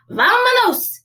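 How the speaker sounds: high, loud Spanish call; rally the gang to set off; rallying cry. Acted. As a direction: exuberant shout to get going. Hero rallies his amigos.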